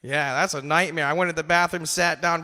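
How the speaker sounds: ordinary delivery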